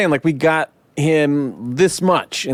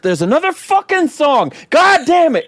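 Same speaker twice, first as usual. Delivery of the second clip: much higher, louder exasperated groan at the film; exasperated outburst; 14 semitones higher, 4 dB louder than usual. Retold or imitated. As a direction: blow up in mock frustration at another song.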